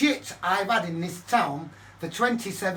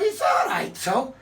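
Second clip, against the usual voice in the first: higher, louder defiant comeback; defiant retort; 4 semitones higher, 4 dB louder than usual. Retold or imitated. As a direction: fire it back, defiant. His defiant reply.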